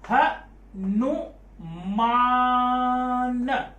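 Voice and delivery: हनुमान sung out very slowly with the long aa stretched; drawn-out vowel. Shown as a demonstration. Teacher stretching हनुमान to show a long syllable. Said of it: "खिंचाव है".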